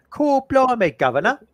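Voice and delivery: hammed-up fake Cockney accent, pitched high. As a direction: do a terrible film Cockney accent.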